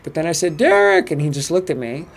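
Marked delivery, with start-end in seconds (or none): 0.6-1.1 high, loud retold call of a name; acted-out hail, pitched up and loud. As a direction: call out to someone across a room. Retells calling out to someone.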